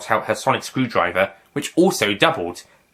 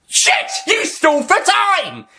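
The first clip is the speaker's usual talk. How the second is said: outraged shriek; 3 dB louder, slower than usual. Acted. Master outwitted.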